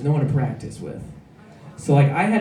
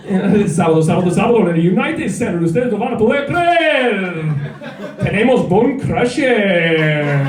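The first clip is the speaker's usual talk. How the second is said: loud hyped Spanish rally announcer, higher; monster truck ad; 4 semitones higher, 3 dB louder than usual. Retold or imitated. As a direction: hype it like a monster truck commercial. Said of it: "practicing monster truck rally advertisements".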